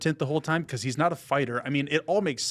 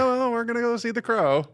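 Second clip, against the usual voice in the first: high-pitched, put-on quote of oneself; acted-out reply; 11 semitones higher, 3 dB louder than usual. Moviegoers answering the counter worker, high put-on voice. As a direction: replay your answer in a much higher voice.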